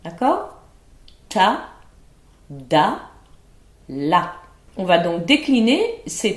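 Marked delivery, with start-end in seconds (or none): 1.3-4.3 crisp, separate diction drill; diction syllables. Shown as a demonstration. Teacher demonstrating the syllables to students, crisp and clear. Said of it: "trois phonèmes assez simples".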